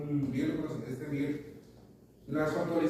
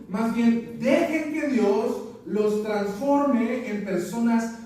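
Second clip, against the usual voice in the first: preaching at full force; 5 semitones higher, 5 dB louder than usual. Acted. Pastor exhorting congregation from Romans, loud emphatic preaching.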